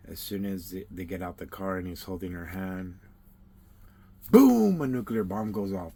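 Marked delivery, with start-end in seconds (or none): 4.3-4.8 loud, high boom; explosion sound. Acted. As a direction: shout the boom like a blast. Nuclear bomb goes off in story.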